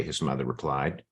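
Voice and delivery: plain reading voice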